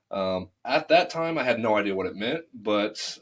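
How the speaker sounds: ordinary delivery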